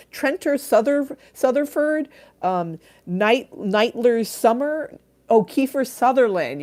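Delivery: high, loud mock mom groping for names; mom fumbling names. Streamer acting a mom who can't find names, high mock fumbling. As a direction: play a confused mom mangling names, slowly. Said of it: "your mom who can't figure out anyone's names".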